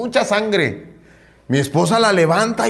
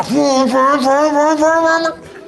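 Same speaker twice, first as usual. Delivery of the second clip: fast high puffing breaths, much higher; puffing sound; 11 semitones higher, 4 dB louder, slower than usual. Retold or imitated. Preacher imitating the taxi driver racing, high hurried puffs. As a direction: puff like a driver racing hard.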